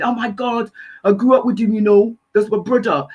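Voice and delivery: lightly voiced DJ impression, excited; a hint of a London DJ friend; [name] voice. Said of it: "that was me doing my best [name] voice".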